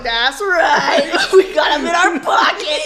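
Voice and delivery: high, loud, laughing brag; mock triumphant boast. Ward member boasting at testimony meeting, gleefully triumphant. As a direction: crow it proudly through laughter.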